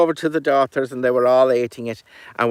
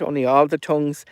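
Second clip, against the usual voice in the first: usual speaking voice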